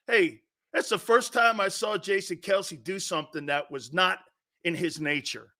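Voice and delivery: ordinary talk, nothing marked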